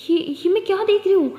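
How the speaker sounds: can't believe her eyes; stunned surprise at the door; disbelief. Acted. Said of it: "यकीन नहीं होता".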